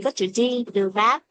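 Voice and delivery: ordinary delivery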